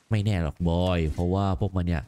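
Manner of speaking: low, grave father voice; dubbing the father, low and heavy; stern warrior father. Acted. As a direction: speak as a hardened warrior father.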